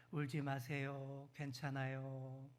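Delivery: slow, soft reassuring words; gentle comfort. Shown as a demonstration. Preacher models comfort for the person beside you, slow and soft. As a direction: say it kindly to your neighbour. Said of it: "옆에 계신 분께 한번 이렇게 말해주겠습니다".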